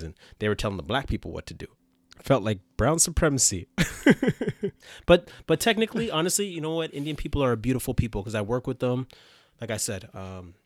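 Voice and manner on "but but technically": laughter spilling into words; laughing. Real, not acted. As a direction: laugh through it. Laughing after joke.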